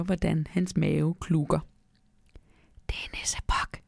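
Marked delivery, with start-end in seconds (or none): 2.9-3.8 soft, hushed whisper; voiceless confiding aside. A narrator's reading. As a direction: whisper it secretly. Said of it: "hvisker jeg".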